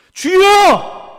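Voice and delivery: a pastor showing how he cries to the Lord; crying out Lord. Shown as a demonstration. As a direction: cry out 주여 as loud as you can. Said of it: "저는 이렇게 합니다".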